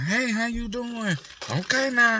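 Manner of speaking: high fake-friendly greeting. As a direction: sing it out sweet and fake. Mock cheerful workplace greeting.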